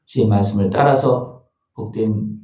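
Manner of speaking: low-pitched, reverent congregational prayer; closing prayer. Real, not acted.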